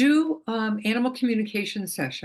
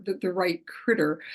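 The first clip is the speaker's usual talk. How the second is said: ordinary delivery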